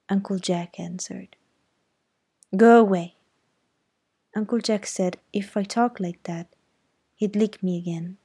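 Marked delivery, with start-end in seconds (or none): 2.6-3.2 much louder sulky shout; angry shout. A narrator's reading. Girl shouting at her uncle through the door, loud angry.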